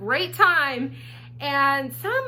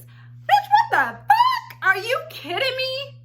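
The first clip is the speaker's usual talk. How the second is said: shocked outburst; 11 semitones higher, slower than usual. Real, not acted. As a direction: let the disbelief explode upward. Shocked reaction to halfway house.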